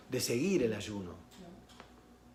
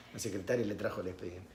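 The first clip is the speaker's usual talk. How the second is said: usual speaking voice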